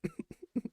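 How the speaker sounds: squeaky high giggle bursting out; retelling how he cracked up with a squeal; high burst of laughter. Retold or imitated. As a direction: let a high laugh escape. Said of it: "but I was like".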